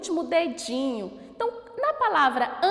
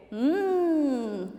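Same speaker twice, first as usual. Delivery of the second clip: ordinary talk, nothing marked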